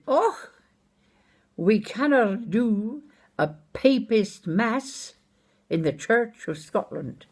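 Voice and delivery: imitating an objecting church elder, high and drawn-out. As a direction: protest slowly in a high voice as a Scottish elder. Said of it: "one of the church elders".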